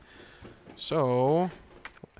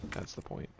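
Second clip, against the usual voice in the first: whispered aside; 11 dB quieter than usual. Real, not acted. Quiet aside to self.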